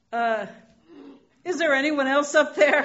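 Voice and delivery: hesitate, then holler for another option; loud hopeful call. Retold or imitated. Dangling man shouts up for someone besides God, hesitant then loud. Man's punchline plea upward.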